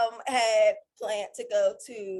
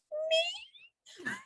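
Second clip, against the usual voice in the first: tiny, very high squeak; startled disbelief; 5 dB quieter than usual. Retold or imitated. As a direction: squeak it softly, as if unexpectedly picked. Retold reaction to surprise test offer.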